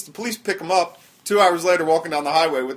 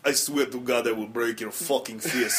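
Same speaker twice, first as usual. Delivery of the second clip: mock tough guy threatening in Russian accent; 2 semitones lower than usual. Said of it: "that's more Russian".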